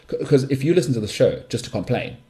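normal talking voice

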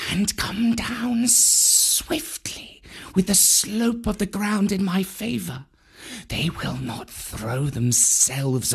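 slow whispered plan. A narrator's reading. Kaa laying out his attack to Bagheera, whispered. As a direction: keep it a hushed snake whisper. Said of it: "Kaa whispered".

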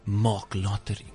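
put-on slow, drawn-out Cape Coloured accent on "Mark Lottering"; imitating the comedian's own Cape Coloured accent. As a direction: say "Mark Lottering" slowly the way the man himself would, in his Cape Coloured accent. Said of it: "Because that's how he talks".

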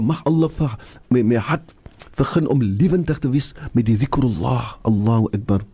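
ordinary delivery

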